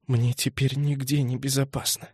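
quiet, hollow, defeated; barely there, all hope gone; crushed voice. A narrator's reading. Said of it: "убитым голосом".

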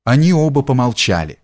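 ordinary delivery

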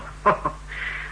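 a short, breathy, scoffing laugh; scornful laugh. Acted. Marcos scoffs at Atrek's simple plan with a breathy laugh. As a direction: huff out a contemptuous laugh.

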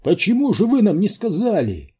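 high-pitched, reproachful question; upset outcry. A narrator's reading. Паунд blames the scientists for not telling, upset. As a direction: cry it out as an accusation. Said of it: "вскричал Паунд".

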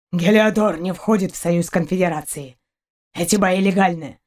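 lightly voiced gruff dog-man; a hint of a rough, low growl. A narrator's reading. Dog-man answers the frightened girl, lightly gruff. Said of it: "отозвался грубым низким голосом пёс".

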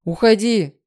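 commanding yell; growled shout. A narrator's reading. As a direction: shout them away with full urgency. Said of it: "зарычал он".

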